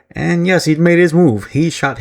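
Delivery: excited fan burst. Real, not acted. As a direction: hype it up like a fan. Hyping the wrestler's move.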